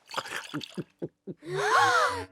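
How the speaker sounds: high, drawn-out gasp of disgust. Acted. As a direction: gasp as the taste hits. King tastes potion, disgusted. (hoquet aigu de dégoût)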